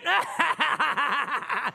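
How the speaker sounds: high jeering cackle; mocking laugh. Guards on the wall laughing at the marchers, high mocking laugh. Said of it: "Imagine their enemies".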